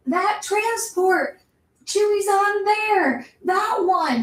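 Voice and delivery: high, slow, hammed-up urgency; exaggerated pointing. Finn pointing Rey at Chewie's transport, high slow exaggerated urgency. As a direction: overplay it like a comedy beat. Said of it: "Finn exaggeratedly points to it".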